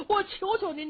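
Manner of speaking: put-on high-pitched old mother's plea; pleading. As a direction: beg anxiously, voice lifted high. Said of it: "老太太这一求啊".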